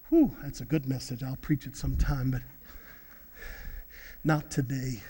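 low, soft aside to the congregation; hushed, low-pitched preacher's aside; offhand aside. Real, not acted. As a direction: drop right down to a quiet remark.

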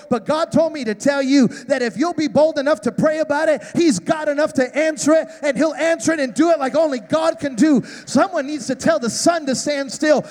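rousing pulpit call; full-force preaching. Acted. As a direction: preach it out to the whole room.

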